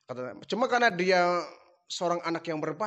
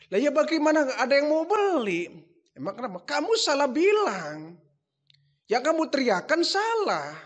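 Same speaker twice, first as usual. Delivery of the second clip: higher, louder scolding; exasperated father; 8 semitones higher, 3 dB louder than usual. Retold or imitated. Father scolds Ibrahim over unsold idols, exasperated. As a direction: scold the foolish son.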